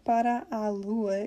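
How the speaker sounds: "para a lua" slowly, both a's separate; words kept apart. Shown as a demonstration. Teacher showing what the singer doesn't say, "para a lua" slowly unmerged. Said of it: "you don't hear him saying".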